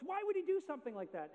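high, loud, puzzled father's question; father demanding an explanation from the coach; baffled father. Retold or imitated. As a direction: ask it in disbelief. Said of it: "the father says to the coach".

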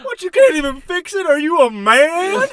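hammed-up country boy jeer. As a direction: needle him like a macho trucker. Mocking redneck trucker shout.

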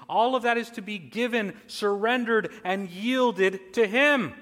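loud, emphatic sermon build, voice climbing; forceful preaching. Real, not acted. Preacher urging all be surrendered to him, forceful.

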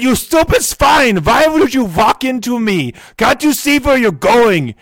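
high, loud angry shouting at a stranger; furious yell. Retold or imitated. Hearing aid yelling at Beethoven who bumped him on the street, furious shout. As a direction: blast him like he just shoved you. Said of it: "I would just yell at him in the manner".

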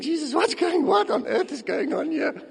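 high-pitched, bewildered questioning; baffled disbelief. Acted. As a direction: stammer out mock astonishment, pitched high. Acting bewildered onlooker questioning Jesus.

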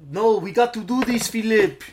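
insistent actor with fake French accent; hammed-up French English; bad put-on French accent. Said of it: "trying to do a franchise".

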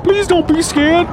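high-pitched coaxing; mock pleading. Rustic character soothing a scared horse, high pleading. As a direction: plead high, as to a frightened animal.

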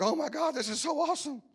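high-pitched imitation of an overcome churchgoer; high, mock-awestruck voice; mock weeping worshipper. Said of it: "starts weeping and crying and shaking".

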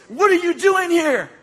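high, loud challenge from an attacker; mock yelled demand. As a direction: demand it while shaking someone. Acts out the attacker demanding answer.

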